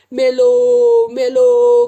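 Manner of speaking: high, very loud wails of pain; pained cry. Acted. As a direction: scream as the slap lands. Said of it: "करून ओरडला".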